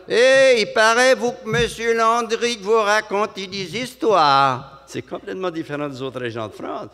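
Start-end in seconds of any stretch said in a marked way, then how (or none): 0.1-4.8 high, slow, loud Vendée drawl; Vendée accent. Retold or imitated. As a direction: sing it like a Vendée local. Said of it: "en Vendée, c'est différent".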